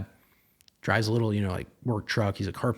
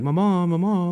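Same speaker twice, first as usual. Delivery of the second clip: put-on high child's wail of Mama, mama; crying child; 7 semitones higher, 4 dB louder, slower than usual. Kid waking dad, calling for missing mother. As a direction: imitate a little kid calling for mom.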